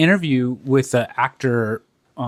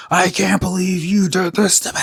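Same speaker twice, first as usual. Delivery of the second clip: high, strained meltdown voice; hammed-up high wail of outrage; mock freak-out; 6 semitones higher than usual. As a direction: imitate someone betrayed and losing it. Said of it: "totally freaking the fuck out".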